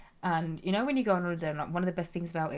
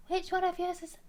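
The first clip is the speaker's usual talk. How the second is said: very high, put-on Scottish voice; Scottish accent; 12 semitones higher than usual. Acting the meme woman coming in, high Scottish accent. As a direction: burst in with high mock-Scottish. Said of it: "And then she comes in".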